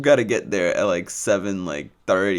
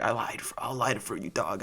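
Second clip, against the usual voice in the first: lightly imitated homie accent, softer; Chris; 9 dB quieter than usual. Classmate offering to light it, light homie accent. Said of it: "I'm not just doing this accent".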